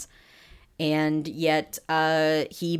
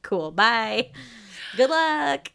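high, loud mock-cheery good luck; sarcastic send-off; 5 semitones higher, 5 dB louder, much slower than usual. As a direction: brush her off with fake cheer. Dismissive send-off, retold quote.

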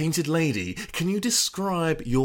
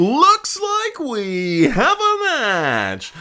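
high, slow drawn-out announcement; game show announcer; 8 semitones higher, 5 dB louder, much slower than usual. Acted. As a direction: stretch it out like a host.

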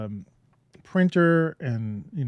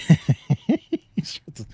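amused laugh at an inside joke; real laugh; 3 semitones higher, 4 dB louder than usual. Real, not acted. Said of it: "that's why I'm laughing".

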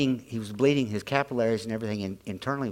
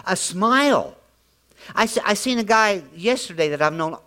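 loud, high, driving preacher's voice; preaching at full force; 8 semitones higher, 7 dB louder than usual. Acted. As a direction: preach it out hard to the room.

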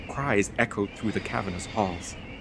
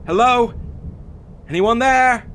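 high, loud call into an empty house; 5 dB louder than usual. A narrator's reading. Delivery man calling into a silent estate, loud and high. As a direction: call out hopefully to whoever's inside.

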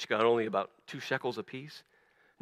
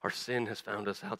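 guilty murmur; 5 semitones lower, 10 dB quieter than usual. Acted. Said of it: "these brothers keep saying to themselves".

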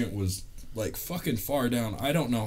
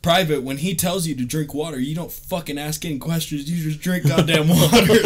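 louder and higher, barking it at the private; drill sergeant yell; 5 semitones higher, 7 dB louder than usual. Retold or imitated. Drill sergeant scolding a recruit in formation, loud angry bark. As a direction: get in his face like a furious drill sergeant.

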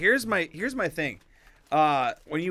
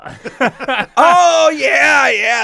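loud high fake gushing; mock enthusiastic wife; 9 semitones higher, 11 dB louder, much slower than usual. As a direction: gush it, way too excited. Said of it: "I'm like".

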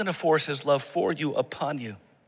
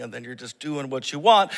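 ordinary delivery

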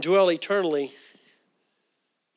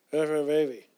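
garbled speech; 2 semitones lower than usual. Retold or imitated. Imitating dying aunt saying "That's my baby", garbled. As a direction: imitate slurred, barely intelligible words. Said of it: "she'd speak like".

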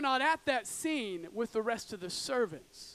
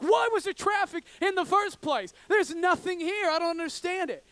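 higher and louder, incredulous complaint; exasperated outburst; 7 semitones higher, 6 dB louder than usual. Retold or imitated. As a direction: throw up your hands at the empty road. Driver annoyed nothing caused traffic.

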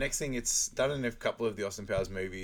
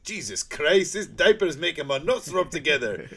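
high, loud comic bellow; Fat Bastard; 8 semitones higher, 9 dB louder than usual. Acted. Sumo wrestler griping about his diaper, hammed-up yell. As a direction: complain at full volume, building higher. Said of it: "they also spot Fat Bastard".

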